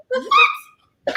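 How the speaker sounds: very high rising squeal; shocked what. Retold or imitated. Hearing the jet prize, squealing shocked what. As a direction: shriek the word in disbelief. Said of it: "I said".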